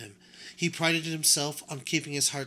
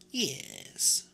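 put-on old grandmother voice. A narrator's reading. Grandmother choosing a doll.

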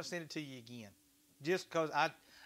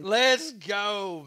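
loud cheer; 3 semitones higher, 10 dB louder than usual. Real, not acted. Cheering viewer's day off.

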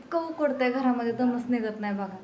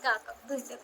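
normal talking voice

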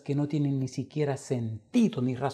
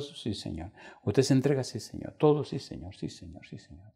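fading weak answers; 6 dB quieter than usual. Retold or imitated. Dying man accepts Christ weakly.

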